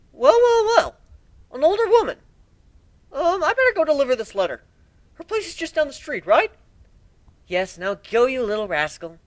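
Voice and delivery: very high, loud sing-song well well well; knowing tease. Acted. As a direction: tease him about an older woman. Twilight then Spike voices.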